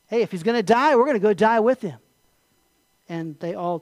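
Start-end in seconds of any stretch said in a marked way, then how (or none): 0.1-2.0 louder, rallying delivery; rally the others with bold readiness; gung-ho resolve. Acted. Thomas urges disciples to go die with Jesus, gung-ho.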